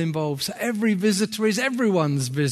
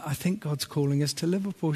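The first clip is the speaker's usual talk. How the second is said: quiet retold suggestion; 3 semitones lower, 8 dB quieter, faster than usual. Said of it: "I said to Charlotte".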